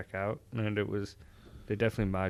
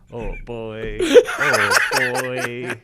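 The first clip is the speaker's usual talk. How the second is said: laughing; 3 semitones higher, 10 dB louder, much slower than usual. Real, not acted. Awkward joke makes him laugh.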